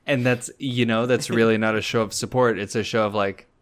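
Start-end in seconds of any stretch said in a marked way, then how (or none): none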